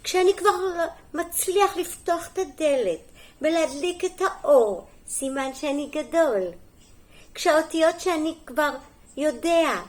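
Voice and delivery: lively, sing-song picture-book reading; story reading to children. Acted. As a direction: read it clearly to small children, lifting the key words. (הקראת סיפור לילדים)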